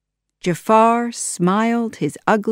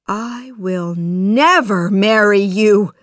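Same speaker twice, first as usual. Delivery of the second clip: slow, louder, swelling indignation; angry refusal; 3 semitones higher, 6 dB louder than usual. A narrator's reading. Princess rejecting the advisor, angry refusal. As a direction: refuse him slowly and forcefully. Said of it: "Jasmine told the advisor angrily".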